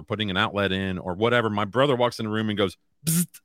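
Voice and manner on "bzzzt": high mock electric buzz; buzzing sound. Brother teasing at the door, a high electric buzz. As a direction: buzz like a live wire zapping. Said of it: "makes that sound".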